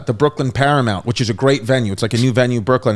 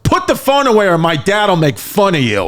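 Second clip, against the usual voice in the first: daughter's warning; 6 semitones higher than usual. Retold or imitated. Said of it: "she goes".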